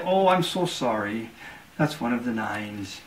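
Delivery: sly, dropping sorry; mock apology. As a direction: gloat behind a sorry. Cardsharp feigns regret, final bet.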